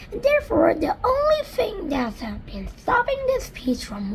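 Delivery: high, earnest lady voice; ladybird voice. Acted. Ladybird explaining the stem to James, high voice.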